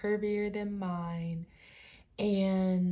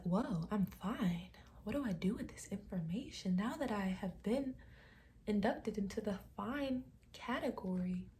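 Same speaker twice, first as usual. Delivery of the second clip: very soft, wondering self-talk; quiet mock-solemn marvelling; hushed mock awe; 11 dB quieter than usual. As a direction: muse softly, as if newly honored. Acting out own awed, solemn reaction.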